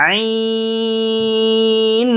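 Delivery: long, intoned "আইন"; three-beat elongation. Shown as a demonstration. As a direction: stretch "আইন" as aayiiiin from the throat. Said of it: "এখানে তিন আলিফ প্রান্ত হবে".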